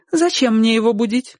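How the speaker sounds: high-pitched female character; woman's voice. A narrator's reading. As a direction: question back as the neighbour woman. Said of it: "ответила женщина". (высокий женский голос)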